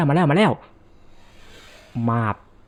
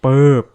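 loud mock click; 8 dB louder, much slower than usual. Mimics switch sound.

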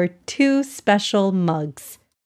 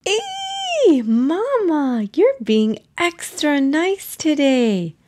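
high excited squeal; 7 semitones higher, slower than usual. Acted. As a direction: squeal with delight at Mama. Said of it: "Aya squealed".

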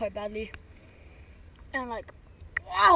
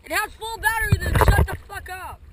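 shouting insults; 7 semitones higher, 13 dB louder than usual. Real, not acted.

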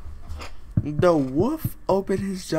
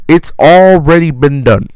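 very loud exasperated yell; loud frustrated shout; 16 dB louder than usual. Real, not acted. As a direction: blast it out in disbelief. Outburst at story logic.

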